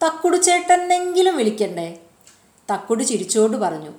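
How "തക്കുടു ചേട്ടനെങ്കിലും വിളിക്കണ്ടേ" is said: high pleading question. A narrator's reading.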